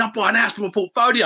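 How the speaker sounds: high, eager and proud; buzzing with pride about a first property; excited. Retold or imitated. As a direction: blurt it out with excitement. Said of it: "I was really excited".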